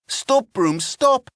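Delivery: rising, panicked shout; cry. A narrator's reading. Apprentice shouts at the runaway broom, panicked. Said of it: "he cried".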